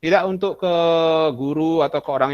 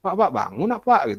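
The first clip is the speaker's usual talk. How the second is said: high, louder whining at the teacher; a whiny kid nagging the teacher; mock whiny student; 6 semitones higher, 4 dB louder than usual. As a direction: beg him to wake up, half-hearted whine. Spamming teacher to wake up.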